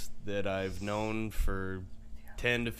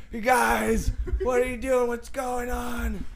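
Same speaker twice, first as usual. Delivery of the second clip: put-on higher pleading voice of the drunk guest; retold plea at the door; 10 semitones higher, slower than usual. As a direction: whine it like a lost drunk at the door.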